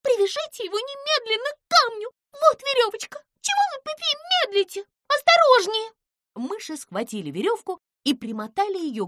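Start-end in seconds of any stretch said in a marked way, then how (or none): 0.0-5.9 high squeaky mouse voice, pushy and urgent; a tiny mouse bossing everyone around; insistent mouse. A narrator's reading. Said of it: "в голосе появилась настойчивость".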